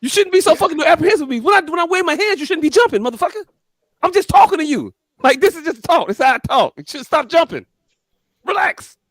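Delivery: high, insistent complaint; exasperated protest. Real, not acted. Telling nervous friends it's just talk, high-pitched and exasperated.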